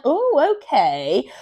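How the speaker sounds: high "oh" falling into a curious okay; curious, mildly surprised; acted wondering. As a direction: play an intrigued gamer, swooping down from high. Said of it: "leaves the gamer wondering".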